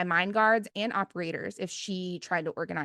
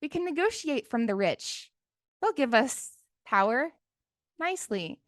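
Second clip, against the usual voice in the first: mock naive voice; 5 semitones higher than usual. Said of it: "'cause they're like".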